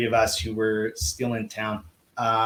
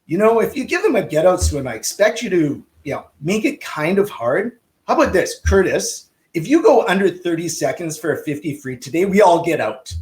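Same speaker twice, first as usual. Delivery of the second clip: higher, louder mock Czech accent; [name]'s bad Czech accent; 6 semitones higher, 5 dB louder than usual. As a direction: lay down terms in a badly faked Czech accent. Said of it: "that's a really bad Czech accent".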